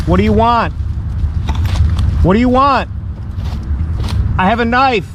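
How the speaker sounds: high, frightened call into the dark; scared challenge. Real, not acted. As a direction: warn off unseen strangers. Threatened camper confronting intruders.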